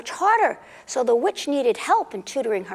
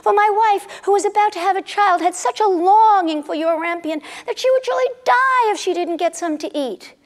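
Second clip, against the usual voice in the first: pleading husband; 6 semitones higher, 3 dB louder than usual. Acted. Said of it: "said the man".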